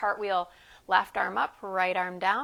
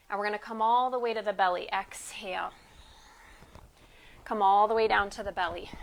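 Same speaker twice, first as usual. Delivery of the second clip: usual speaking voice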